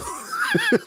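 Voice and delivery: a sudden shrieking laugh; burst of high laughter. Real, not acted. Laughs at own lisp joke.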